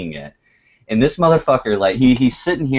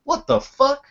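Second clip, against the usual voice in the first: high, squeaky startled imitation; startled curse. Imitating Tony startled on camera, high squeaky curse. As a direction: mimic a friend jumping at a noise. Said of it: "He just goes".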